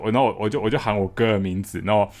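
ordinary delivery

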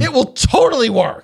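high, insistent character voice; excited goblin. Acted. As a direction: eagerly vouch for your invention. Excited goblin defends his invention.

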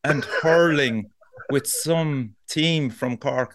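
very slow, drawn-out mock neighbour; slow talker. Neighbour droning about football and hurling, very slow. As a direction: drag the words out endlessly. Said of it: "one of those slow talkers".

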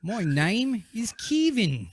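slow, high, drawn-out attempt at Australian; Australian accent. Shown as a demonstration. Host struggling to prove his accent, hammy Australian. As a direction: stretch it out in a badly faked Australian accent. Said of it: "Australian. Ready?".

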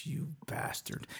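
slow, whispered curse; very soft, drawn-out aside; hushed curse. Real, not acted. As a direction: whisper it slowly, under the breath. Mock curse after a slip.

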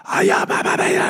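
imitated ranting in gibberish; hoarse mock yell of nonsense syllables. Imitating an irate driver ranting in Armenian, mock yelling. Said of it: "he is level ten yell".

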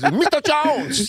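put-on high, slow, shrieked kid accent; Short Round impression. Prank kid yelling at hotel guest, high Short Round voice. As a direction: wail the name like Short Round. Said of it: "It sounded like Short Round".

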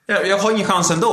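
high-pitched hopeless protest; angry, resigned. Retold or imitated. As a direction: voice the exasperation of giving up. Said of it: "jag blev så arg och uppgiven".

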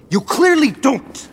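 high, loud angry retort, drawn out. Acted. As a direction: throw it back at them, slow and loud. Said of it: "lower your voice".

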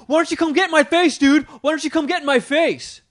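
high, loud mimicked taunt; retold fight-picking. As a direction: goad him like a riled attendant. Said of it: "trying to pick a fight with me".

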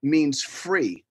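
slow and deliberate. Real, not acted. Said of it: "explaining the name meaning".

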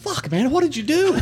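very high retold complaint; high-pitched, winded-sounding protest; indignant protest. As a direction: squeal it in mock outrage. Retold protest after being released.

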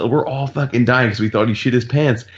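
usual speaking voice

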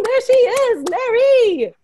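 high, loud cheering shout; big hype-up greeting, very high and loud; excited welcome. Real, not acted. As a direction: whoop the guest's name as she comes in. Host cheers guest onto the panel.

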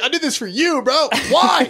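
high-pitched, pleading wail; whiny dramatic guy. Retold or imitated. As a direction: beg like a martyr. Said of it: "being like".

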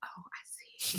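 breathy hushed whisper. Retold or imitated. Aunt spotting the bad word in the video, whispering. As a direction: whisper it to yourself. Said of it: "and I was like".

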